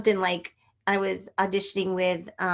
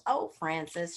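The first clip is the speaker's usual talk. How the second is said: soft, lower, easygoing mom; laid-back mom; 3 semitones lower, 5 dB quieter than usual. Acted. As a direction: say it softly, like an unbothered mother. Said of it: "the mom was really laid back".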